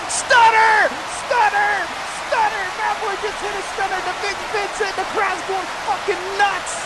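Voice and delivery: high-pitched shouted move call, then racing on; excited play-by-play. Real, not acted. Commentator calls a sudden stunner, excited. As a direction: burst out high as the move lands.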